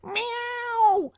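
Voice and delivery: very high, long-held yowl; piercing cartoon cat screech. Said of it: "screeched Ginger".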